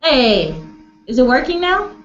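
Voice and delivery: ordinary delivery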